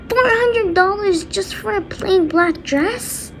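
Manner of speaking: high, drawn-out outraged question; mock parental outrage; indignant disbelief. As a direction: balk at the price, pitch climbing. Dad balking at the price.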